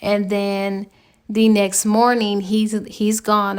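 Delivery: ordinary delivery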